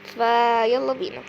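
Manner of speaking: ordinary talk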